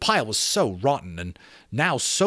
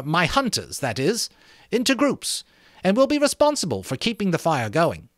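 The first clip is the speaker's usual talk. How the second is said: lightly voiced boy, higher, pleased with himself; proud schoolboy; 10 semitones higher, 4 dB louder than usual. Jack taking charge before the assembly, proud. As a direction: boast a little about being English.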